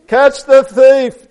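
slow, high, booming alarm; drawn-out storybook holler; trumpeting shout. A narrator's reading. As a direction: trumpet it out like an elephant. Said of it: "trumpeted Elephant".

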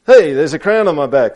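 high, loud storybook tortoise; loud, high-pitched discovery; excited squeak. A narrator's reading. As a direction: squeak it out to a room of kids. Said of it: "squeaked Tortoise".

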